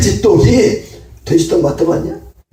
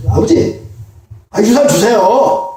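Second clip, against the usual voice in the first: 떼쓰는 막내아들; 6 semitones higher, slower than usual. Acted. 막내아들이 유산 달라 조름.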